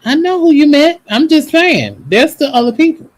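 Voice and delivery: loud insistent retort. Real, not acted. Arguing back at her co-host.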